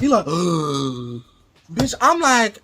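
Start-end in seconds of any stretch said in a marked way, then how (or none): 0.3-1.2 imitated long, sucking gasp; revival gasp. Imitating the man coming back to life, big gasp. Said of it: "He comes back to life".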